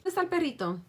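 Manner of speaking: put-on loud, bright toddler read-aloud voice; reading to a child. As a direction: quiz the toddler on animals. Reads picture book to toddler.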